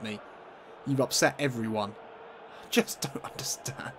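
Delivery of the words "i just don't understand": whispered, soft and hopeless; defeated whisper. Real, not acted. Gamer stunned by his defence, defeated whisper. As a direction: breathe it out in disbelief. Despair after conceding.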